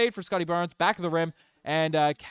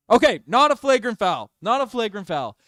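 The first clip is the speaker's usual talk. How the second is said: louder and higher, relieved call on the ref decision; excited outburst; 6 semitones higher, 8 dB louder than usual. Real, not acted. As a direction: blurt it out, thrilled the foul is downgraded.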